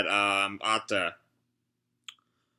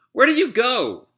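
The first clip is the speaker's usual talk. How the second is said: put-on much higher little boy's plea; child voice; 11 semitones higher than usual. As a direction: ask like a small scared kid. Child asks returning dad.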